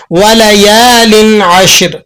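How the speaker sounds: slow tajweed recitation of وَلَيَالٍ عَشْرٍ without ghunnah; Quran recitation. Shown as a demonstration. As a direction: recite it slowly with no nasal hum on وَلَيَالٍ. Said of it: "ہم یہاں پر غنہ ادا نہیں کریں گے".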